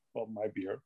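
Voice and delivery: ordinary talk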